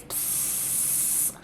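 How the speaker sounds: long voiceless hiss of a stream; imitated peeing hiss.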